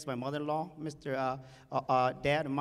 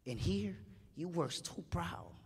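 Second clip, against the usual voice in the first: quiet upset plea; 8 dB quieter than usual. Retold or imitated. Son's tearful plea to dad.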